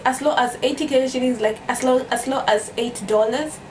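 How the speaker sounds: ordinary talk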